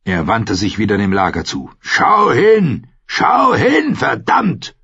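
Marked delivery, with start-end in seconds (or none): 1.8-2.9 high, loud command, punch hin; shouted order. Acted. Clegane orders Arya to look at the slaughter, loud shouted command. 3.1-4.8 harder shouted order. Acted. Clegane forcing Arya to look.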